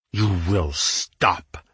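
very slow, menacing growl. A narrator's reading. Hayden orders Winter to stop goading him, growling. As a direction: growl it word by word. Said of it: "he growled".

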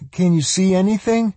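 put-on high, eager young girl. A narrator's reading. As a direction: voice Swan, excited and high. Swan asks, high voice.

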